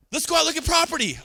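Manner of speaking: high, loud, fast salesy push; mock a pushy agent hounding a client. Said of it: "Now you're telling them they're stupid".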